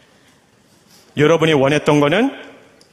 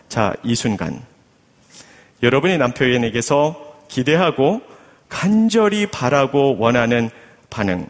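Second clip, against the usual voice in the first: ordinary talk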